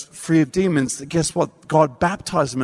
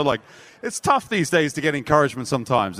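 ordinary talk, nothing marked

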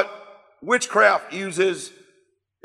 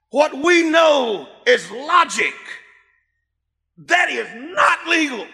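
preaching shout; 6 semitones higher, slower than usual. Real, not acted. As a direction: hammer it home from the pulpit. Preacher building to shouted point.

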